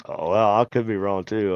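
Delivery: usual speaking voice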